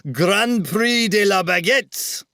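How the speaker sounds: a touch of mock French on the name; French accent. Host announcing the Paris prize, light French accent.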